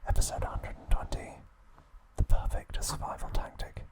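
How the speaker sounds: normal talking voice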